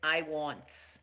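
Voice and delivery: low, lightly mocking "I wants"; mocking bad grammar. Host mocking sloppy live-video grammar, low and lightly scornful. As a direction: sneer "I wants" like a clueless poster. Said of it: "talking about".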